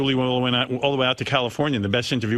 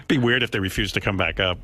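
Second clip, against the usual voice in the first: ordinary talk, nothing marked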